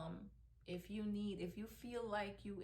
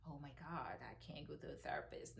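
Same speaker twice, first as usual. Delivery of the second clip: mock worried inner voice; 4 semitones lower, 6 dB quieter than usual. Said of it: "I used to think".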